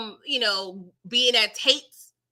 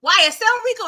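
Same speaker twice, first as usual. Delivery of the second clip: high startled disbelief; 10 semitones higher, 3 dB louder than usual. Retold or imitated. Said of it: "He was like".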